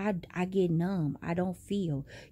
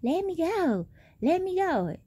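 high, whiny mock plea; lightly hammed-up let me go; mocking the girl; 11 semitones higher than usual. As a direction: imitate her straining against the boyfriend. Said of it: "She was all like".

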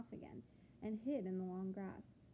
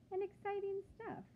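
excited; 10 semitones higher than usual. Acted. Enthusing to children.

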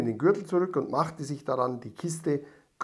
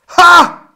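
huge angry yell; furious shout; 19 dB louder than usual. A narrator's reading. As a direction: explode with rage. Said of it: "schrie ... zornig". (zorniger Aufschrei)

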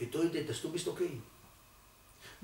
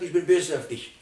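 louder mock scolding of the child; much louder father's rebuke, the wrong way; 4 semitones higher, 11 dB louder than usual. Shown as a demonstration. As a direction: snap it at the son as the wrong example.

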